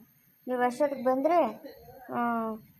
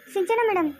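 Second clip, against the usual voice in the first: ordinary talk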